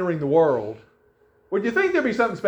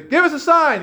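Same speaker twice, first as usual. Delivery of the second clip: raised, louder crowd demand; insistent demand; 12 semitones higher, 7 dB louder than usual. Acted. Crowd demands a sign from Jesus, high insistent demand. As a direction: call it out high, pressing for an answer.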